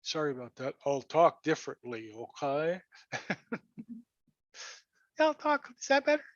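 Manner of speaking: normal talking voice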